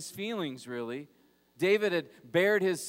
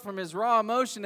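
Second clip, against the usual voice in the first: normal talking voice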